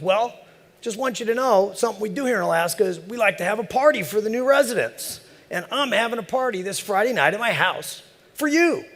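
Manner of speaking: high, hearty backwoods voice; folksy old neighbor. Retold or imitated. Neighbor invites him to party.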